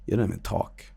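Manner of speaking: low, soft, dismissive voice; disgusted intruder. Retold or imitated. Dream intruder dismissing the streamer after a video, quiet contempt. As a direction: play a quietly fed-up intruder.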